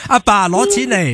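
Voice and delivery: high, drawn-out call to dad; hammed-up kid asking dad for cash; mock demanding child. Jokingly voices child demanding money.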